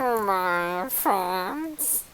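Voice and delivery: slow, soft, creepy drawn-out greeting; creepy rat voice. Acted. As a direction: draw it out like a sly rat. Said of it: "He does that better than I do".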